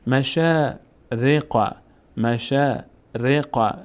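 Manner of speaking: مشارق recited slowly with a long shaa; slow recitation. Shown as a demonstration. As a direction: draw out مشارق for learners.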